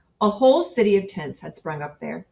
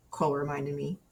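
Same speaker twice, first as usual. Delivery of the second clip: ordinary delivery, nothing marked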